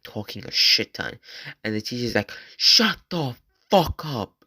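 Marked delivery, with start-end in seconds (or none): 2.6-4.3 loud, high, drawn-out retold shout; angry teacher yell. Teacher silencing the class clown, angry mock shout. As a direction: imitate a fed-up teacher yelling, stretching each word.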